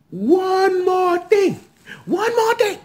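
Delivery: high, slow, drawn-out build-up; excited tease. Real, not acted. Teasing the audience before the big reveal, high and drawn out. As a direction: dangle it in front of them.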